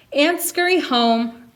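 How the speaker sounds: plain reading voice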